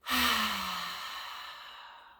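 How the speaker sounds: slow audible sigh out through the mouth; long exhale sigh. Shown as a demonstration. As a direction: let the breath go with a long sigh. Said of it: "And again".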